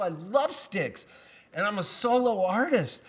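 normal talking voice

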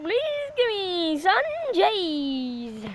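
very slow, whining fake sob; hammed-up begging to God; mock crying plea.

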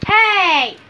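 sharp loud yell; loud shout. Pretend. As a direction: shout to stop them. Shouted call.

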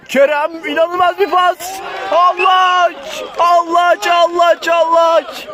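high, loud repeated name chant; shouted goal call. Real, not acted. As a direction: yell the scorer's name over and over. (bağırarak gol anlatımı)

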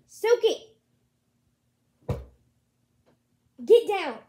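loud, high shout of the cat's name; yelling at the cat. Real, not acted. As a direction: yell across the room to stop her.

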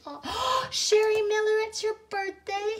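gasp, then high-pitched delight; thrilled high-voiced surprise; excited birthday greeting. Real, not acted. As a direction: exclaim it way up high on discovering a birthday. Spotting a viewer's birthday.

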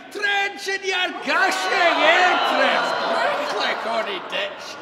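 booming dramatic Scottish recitation; poem recitation. Acted. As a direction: declaim the poem with full theatrical force. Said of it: "the address to a haggis".

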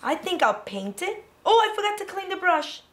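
ordinary talk, nothing marked

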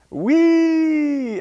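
long, high, loud squeal; gleeful whee. A narrator's reading. Tortoise sliding across the ice, gleeful squeal. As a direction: let out a sustained joyful whee.

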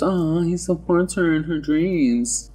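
high, drawn-out swooning aww; mock gushing. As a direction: coo it slowly, in mock adoration. Gushing over a romantic gesture.

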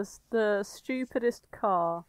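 ordinary delivery

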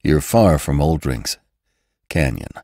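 low, mildly winded voice; spent, understated reassurance with labored breaks; effortful speech. A narrator's reading. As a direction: pause for breath before the last word. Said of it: "The effort it cost him to speak".

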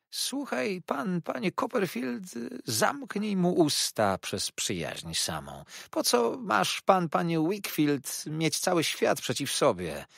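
high, slow, drawn-out warning. Acted. Jurajach urges Copperfield to silence Wakefield, warningly. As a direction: draw the words out high, pressing the threat home. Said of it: "ciągnął ostrzegawczo Jurajach".

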